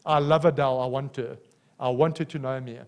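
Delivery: low, soft and tender, as God speaking; gentle divine voice pleading over a broken singer; voice of the Holy Spirit. Acted. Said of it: "And the Holy Spirit says".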